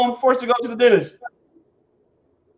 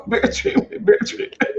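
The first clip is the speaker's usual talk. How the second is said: reading through laughter; 11 semitones higher, 3 dB quieter than usual. Retold or imitated. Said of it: "It says".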